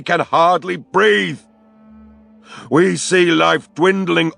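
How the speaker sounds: furious high bellow at the spirits. A narrator's reading. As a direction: hurl it at the sky in grief and rage.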